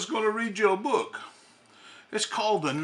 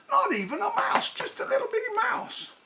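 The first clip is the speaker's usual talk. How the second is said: squeaky voice, much higher; tiny mouse voice; 13 semitones higher than usual. Acted. Santa reading to kids, squeaky tiny mouse voice.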